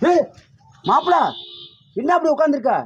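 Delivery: high-pitched, astonished questioning; alarmed, high questions; shocked concern. Acted. Finds friend sitting in a hut.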